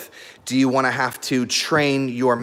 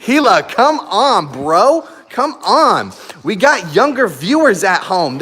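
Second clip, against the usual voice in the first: louder, higher exasperated scolding; mock scolding; 5 semitones higher, 5 dB louder than usual. Real, not acted. Owner scolding husky in front of young viewers, louder. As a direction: call the dog off, half laughing. Scolding dog during livestream.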